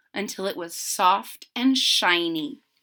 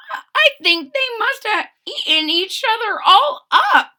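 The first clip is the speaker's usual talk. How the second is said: put-on high worried old woman voice; a lightly voiced old woman fearing the worst; 12 semitones higher, 6 dB louder than usual. Old woman tells husband the cats ate each other, high and worried. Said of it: "said the very old woman".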